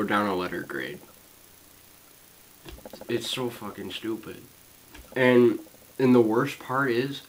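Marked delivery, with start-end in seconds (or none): 3.1-4.4 soft, deflated muttered complaint; quiet resignation. Real, not acted. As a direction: let the anger drain out. Rant dies into resignation.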